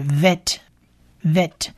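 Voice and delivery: vet said slowly with a v, twice with a pause; vet pronounced with v. Shown as a demonstration. Teacher explains Bet without a dot, slow vet demo. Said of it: "所以是".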